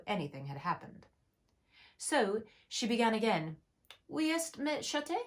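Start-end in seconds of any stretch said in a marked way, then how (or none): 4.1-5.1 higher, careful French lesson phrase; lightly raised, careful schoolbook French; schoolgirl French. Acted. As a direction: recite it like a textbook French line. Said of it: "first sentence in her French lesson book".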